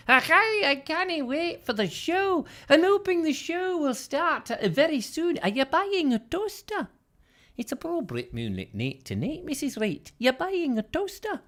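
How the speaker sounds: high-pitched mock Scottish voice; Scottish accent. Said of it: "she's suddenly gone Scottish".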